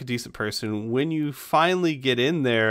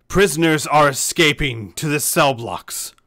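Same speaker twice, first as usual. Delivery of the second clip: booming captain over a loudspeaker; magically amplified announcement; 2 semitones higher, 6 dB louder than usual. Acted. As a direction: call it out like a magical megaphone. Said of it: "the voice of Captain Taper".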